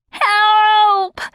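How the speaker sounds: high, loud creature cry for help; screamed. A narrator's reading. Ferret pinned under the cat, screaming for help. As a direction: shriek it out in panic. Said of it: "the ferret screamed".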